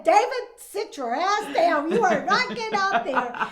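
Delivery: loud firm command to sit down; stern order. Retold or imitated. Wife ordering her husband to stay seated, loud stern command. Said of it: "I said".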